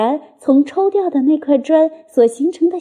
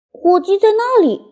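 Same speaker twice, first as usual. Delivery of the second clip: put-on little fox child; 8 semitones higher than usual.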